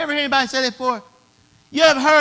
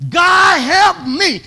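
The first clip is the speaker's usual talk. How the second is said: louder and higher cry to God; desperate plea; 6 semitones higher, 6 dB louder, much slower than usual. Retold or imitated. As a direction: cry out for help like someone at the breaking point. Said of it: "three to four words you gonna say".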